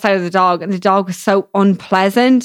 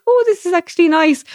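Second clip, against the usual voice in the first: pleasant surprise; 10 semitones higher than usual. Retold or imitated. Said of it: "was like".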